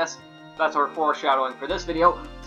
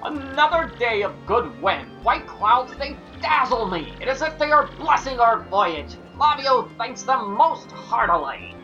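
higher foppish dandy voice; affected fluting fop; 4 semitones higher than usual. Acted. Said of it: "that's gonna be Flavio's voice now".

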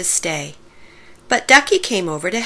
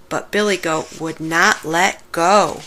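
plain reading voice

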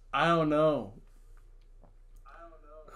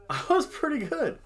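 laughing. Real, not acted. Laughing at own prediction score.

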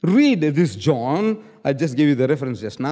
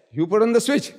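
ordinary delivery

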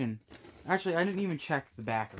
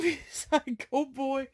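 usual speaking voice

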